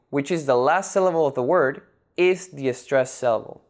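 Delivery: usual speaking voice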